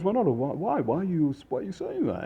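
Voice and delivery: high-pitched imitation of baffled guests; puzzled protest. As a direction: stammer out a bewildered question. Guests question the waiter in story.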